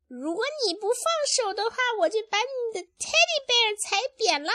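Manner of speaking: very high, fast little-sister voice; squeaky rapid-fire threat; Rosie. Acted. Parent voicing the sister's threat, high and fast. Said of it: "然后Rosie， 那个他的妹妹说".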